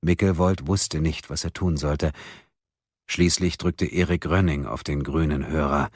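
ordinary delivery

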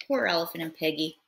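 plain reading voice

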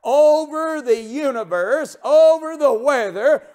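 high, loud, tearful preaching cry; preaching at full force. Acted. Preacher to the congregation on God's power, full-force tearful preaching.

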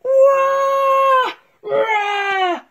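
loud high startled roar. Acted. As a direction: bellow out. Bear wakes with roar.

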